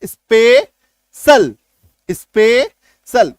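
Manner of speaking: Special stretched out very slowly, falling; slow model word. Shown as a demonstration. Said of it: "teacher modelling a word".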